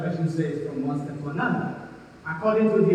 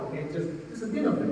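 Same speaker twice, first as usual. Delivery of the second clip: ordinary delivery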